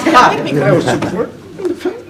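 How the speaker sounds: choked up and pushing the words out; voice cracking. Real, not acted. Sworn in as councilman, his voice cracks with emotion. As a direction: pitch jumps high, voice breaking with emotion. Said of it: "and I did".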